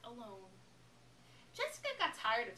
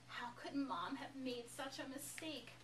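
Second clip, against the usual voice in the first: usual speaking voice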